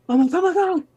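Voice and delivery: warning friends about the sliding glass, panicked and fast; panicked alarm. Retold or imitated. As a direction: blurt it out in a panic. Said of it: "And I'm like, gasps".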